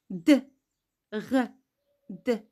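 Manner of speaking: bare consonants D, R, D, one by one; mute E. Shown as a demonstration. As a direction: release D, R, D separately without a full 'euh'. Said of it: "Parce qu'on a un E muet".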